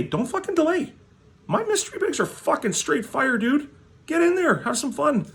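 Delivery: very high, excited hype voice; hyped sales pitch. Acted. Vlogger hyping his mystery bags to viewers, very high excited. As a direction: pump up the crowd in a squeaky high push.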